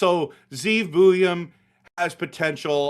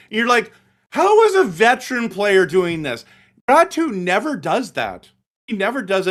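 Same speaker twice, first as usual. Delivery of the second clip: heated rant; 4 semitones higher, 7 dB louder than usual. Real, not acted. Heated rant about benching player.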